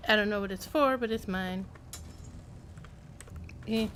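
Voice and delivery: ordinary talk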